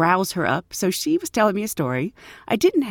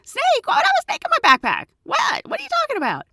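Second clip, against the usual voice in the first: much higher mock-innocent kid voice; kid playing dumb; much faster than usual. Boy denying the snake to the flight attendant, high mock innocence. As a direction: squeak out the denial like a guilty boy. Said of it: "And the kid's like".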